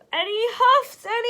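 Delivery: high, loud, breathless last huff; one final desperate blow; exhausted huff. Acted. Wolf's last effort at the brick house, straining and breathless. Wolf gasping from blowing.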